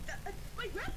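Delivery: high, frantic cry of longing; frenzied cry. Retold or imitated. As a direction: shriek for the relic as she lunges. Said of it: "in this mist of just saying".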